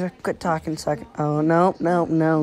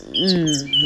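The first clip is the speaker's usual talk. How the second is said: ordinary talk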